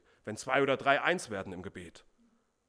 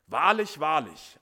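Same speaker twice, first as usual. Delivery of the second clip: Wahrlich called out loud, like a herald; emphatic call to attention; 8 dB louder, slower than usual. Shown as a demonstration. Preacher shows the congregation the solemn double call, loud and emphatic. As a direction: proclaim it so everyone listens up. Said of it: "Achtung, aufgepasst, jetzt wird's wichtig".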